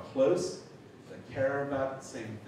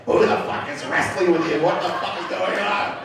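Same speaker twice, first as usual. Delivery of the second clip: loud, high imitated outrage; full-voiced mock tirade; yelling caller; 7 semitones higher, 12 dB louder than usual. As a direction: bellow down the phone, baffled and livid. Caller yelling through the phone.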